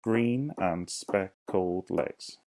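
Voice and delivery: each syllable said slowly and apart; slow syllable count. Shown as a demonstration. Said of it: "Let's count them".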